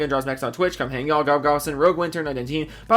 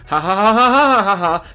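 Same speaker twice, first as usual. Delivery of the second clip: hammed-up laugh; 5 semitones higher, 7 dB louder than usual. Reading a silly name in credits.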